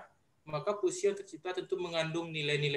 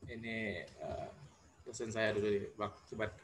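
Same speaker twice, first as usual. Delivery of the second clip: barely audible; 3 semitones lower, 5 dB quieter than usual. Real, not acted. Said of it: "suaranya terlalu kecil".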